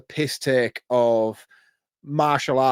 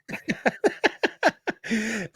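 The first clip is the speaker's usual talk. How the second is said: big burst of laughter; 9 semitones higher, 6 dB quieter than usual. Real, not acted. Cracking up at impression.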